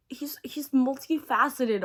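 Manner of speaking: ordinary delivery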